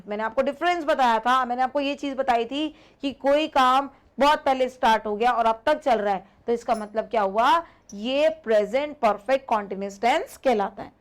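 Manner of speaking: ordinary talk, nothing marked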